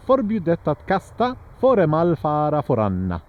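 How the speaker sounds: Förbjudet att kasta föremål fara föranna with a singsong lilt at the ends; mock Swedish singsong, lilting line ends. Shown as a demonstration. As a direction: imitate the Swedish melody, a little hammed. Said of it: "kind of singing at the end".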